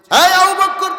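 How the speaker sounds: high-pitched call by name. Retold or imitated. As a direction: hail him sharply from a distance. Prophet calls Abu Bakr, retold. (জোরে নাম ধরে ডাক)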